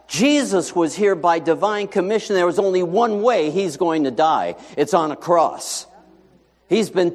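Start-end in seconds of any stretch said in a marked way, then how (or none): none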